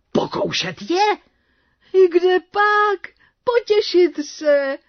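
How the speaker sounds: drawn-out, high questioning; high-pitched protest. A narrator's reading. Devil assures the court he only wants company, high protest.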